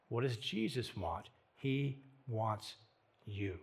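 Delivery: very soft, low and spaced out; hushed appeal. Real, not acted. Preacher telling listeners what Jesus wants, hushed. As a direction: drop low and let each word land gently.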